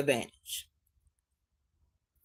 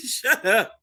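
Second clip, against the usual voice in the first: higher, laughed-out retort; laughing; 8 semitones higher, 4 dB louder than usual. Real, not acted. Teasing Tamia over a chat joke, laughing. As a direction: crack up while saying it.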